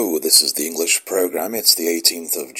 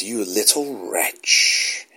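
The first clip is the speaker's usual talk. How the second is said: slow snarling wolf; 4 semitones higher, much slower than usual. A narrator's reading. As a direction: growl it slowly like a wolf. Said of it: "the wolf began to snarl".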